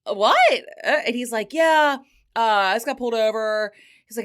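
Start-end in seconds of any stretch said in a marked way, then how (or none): 0.1-0.6 high, loud disbelieving "What?"; shocked what. Retold or imitated. Telling how she reacted to her date's news, stunned and high. 1.5-3.7 imitating date. Date quoted casually.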